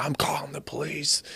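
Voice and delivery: breathy hushed whisper, voice dropped; retold whisper. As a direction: whisper it like a secret you're urgently sharing. Grandmother reacting to abuse story.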